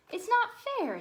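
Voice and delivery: a jealous stepsister whining it out; hammed-up sulky girl protest; angry girl. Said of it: "said an angry girl".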